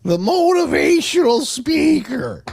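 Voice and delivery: slow, high, booming mock Matt Foley voice; motivational speaker impression. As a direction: ham it up like a shouting motivational speaker. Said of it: "She is Matt Foley".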